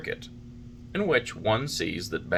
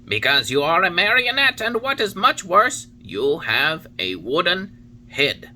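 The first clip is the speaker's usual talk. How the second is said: higher, louder solemn cricket warning; Talking Cricket warning; 9 semitones higher, 5 dB louder than usual. A narrator's reading. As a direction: preach it like a wise old insect. Cricket's last rebuke.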